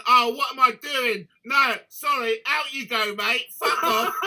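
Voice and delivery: high, loud, half laughing as he throws him out; backing out in a hurry, laughing at himself; loud panicked brush-off. Retold or imitated.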